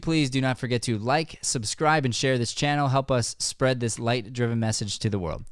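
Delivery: cheerful host thanking the audience; show host outro. Acted.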